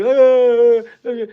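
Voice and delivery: high loud fake whimper; high hammed-up whine; mock wail. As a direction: mimic a fragile man crying out. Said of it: "you say one thing to me".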